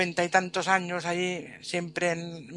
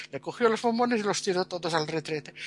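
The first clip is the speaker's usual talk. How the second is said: ordinary delivery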